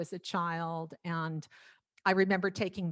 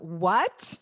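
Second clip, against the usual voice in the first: retold startled "what"; rising, taken-aback question; surprised; 5 dB louder than usual. Told singing lessons are off, surprised. Retelling her startled reply.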